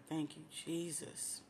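emotional thanks. Real, not acted. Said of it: "I'm getting so emotional right now".

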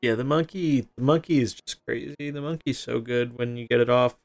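ordinary talk

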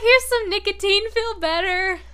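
high, loud cheery offer; laughing cheery voice; mock caring. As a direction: play the sweet partner with a gift. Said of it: "they're like".

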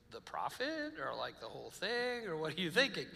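acted-out tentative asking. Couple cautiously sounding each other out.

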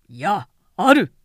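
loud, forceful no, there is; emphatic declaration. Acted. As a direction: cut him off with sudden force.

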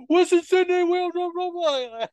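high, loud, mumbled Australian impression of a fighter; thick Australian accent. Host imitates fighter's thick Australian interview, high and loud. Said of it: "Australian dialect was so thick".

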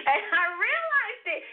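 high, laughing disbelief. Real, not acted. Telling how her arm healed, laughing in delight. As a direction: laugh as the realization hits.

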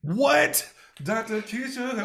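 loud, high disbelieving cry; playful astonishment. Real, not acted. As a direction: exclaim it high, as if amazed. Co-host reacts to Twitter claim.

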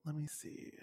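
whispered to herself while thinking; whispering. Real, not acted. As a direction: breathe it out, barely voiced. Thinking aloud before answering.

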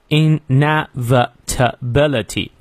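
"inevitability" broken into separate syllables. Shown as a demonstration. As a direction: slowly sound out each syllable of "inevitability". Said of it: "音节比较多". (“inevitability”一个音节一个音节地慢念)

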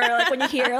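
ordinary talk

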